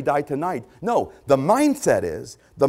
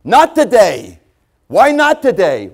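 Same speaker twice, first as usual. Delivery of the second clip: mock excuse; 7 semitones higher, 11 dB louder than usual. Mocking the excuse-maker.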